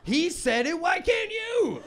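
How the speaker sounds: high, loud, slow whiny heckler; mock indignant heckler. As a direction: whine like a heckler who feels wronged. Voicing heckler complaining.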